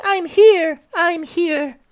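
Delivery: tiny high-pitched voice for a lost die; mock yelling out. Lost die calls out to the player, mock high yell. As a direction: squeak it out as a pretend far-off cry. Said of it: "he was yelling out to me".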